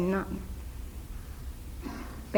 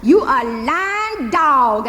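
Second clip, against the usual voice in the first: slow, louder insult; bitter insult; 4 semitones higher, 7 dB louder, much slower than usual. Acted. Hazel calling her uncle a lying dog, slow and bitter. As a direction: spit it at him slowly.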